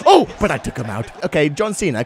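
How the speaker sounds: ordinary talk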